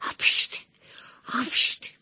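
two breathy sneezes; acted sneeze. A narrator's reading. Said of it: "عطسه کرد".

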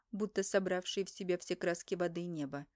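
ordinary delivery

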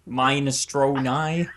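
Minestrone said as MINE-uh-stroh-nigh; deliberate mispronunciation. Shown as a demonstration. Host mocking friend's logic, Minestrone as MINE-a-stro-nigh. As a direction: slowly give Minestrone long I's, "mine-a-stro-nigh". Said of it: "how do you say that?".

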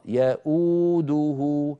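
يؤوده slow, hamza then a pronounced و; tajweed demo. Shown as a demonstration. Teacher repeating يؤوده to show the spoken و. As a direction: say يؤوده slowly, the و clearly voiced. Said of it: "والواو المكتوبة هي المنطوقة".

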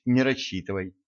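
usual speaking voice

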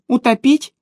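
put-on high-pitched boy's question; eager boy henchman. Boy henchman asks about the prisoner's fate, high questioning. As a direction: ask it high, as the wicked boy.

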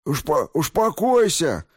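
high, stumbling lisp. A narrator's reading. Injured thug pleads with his attacker, lisping. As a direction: lisp through a smashed mouth. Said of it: "прошепелявил мужик".

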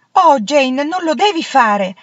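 high, loud scandalized outcry; shocked cry. A narrator's reading. Anna begs her friend Jane not to whip children, shocked outcry. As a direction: cry out in horror at the idea. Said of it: "gridò Anna scandalizzata".

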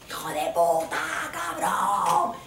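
screaming in the street. Retold or imitated. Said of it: "me puse a gritar en la calle".

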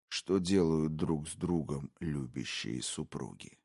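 low, hushed, gloating whisper; a predator savouring every word; menacing intruder. Acted. Intruder taunting the wife, low sinister whisper. Brogan's voice.

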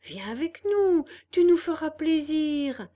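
put-on loud, high chorus of boys; crying out. A narrator's reading. Boys in the cart calling to Pinocchio, loud. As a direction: call to him together. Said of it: "crièrent trois ou quatre voix".

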